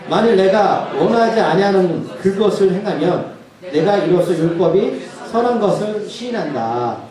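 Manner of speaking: slow, weighty reading of the verse; scripture reading. Acted. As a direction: read the scripture slowly and solemnly. Reads verse seven sixteen aloud.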